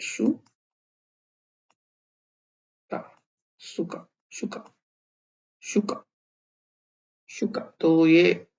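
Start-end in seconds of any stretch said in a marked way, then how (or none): 3.6-7.8 शुका breathed softly, almost whispered; model pronunciation. Shown as a demonstration. Teacher models शुका quietly for learners. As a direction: ease into शुका gently. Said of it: "यहाँ पे pronounce है शुका".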